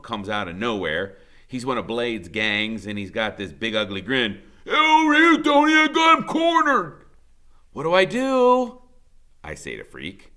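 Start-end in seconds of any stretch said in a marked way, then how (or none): 4.6-7.1 high, loud taunting shout; punk calling out. A narrator's reading. Punk yelling to his buddy, loud taunting call. 7.7-8.8 high, loud and urgent; panicked question. A narrator's reading. Boy in a chase asking Freak for help, panicked.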